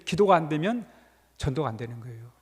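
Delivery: ordinary delivery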